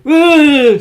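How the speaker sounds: high, loud mock scream; imitating women screaming. The two visiting ladies screaming at him, high mock shriek.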